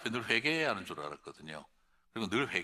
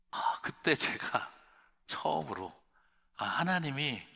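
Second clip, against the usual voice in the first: usual speaking voice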